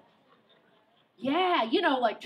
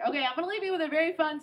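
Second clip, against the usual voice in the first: usual speaking voice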